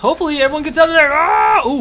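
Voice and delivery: high fast excited shout; excited yelp. Real, not acted. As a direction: yelp it out, rising in alarm. Teamfight panic in the game.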